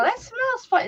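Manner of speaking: high, pleased remark; mock delighted sniff. Aunt sniffing the plant, high pleased tone. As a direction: savour the smell. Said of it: "and I go".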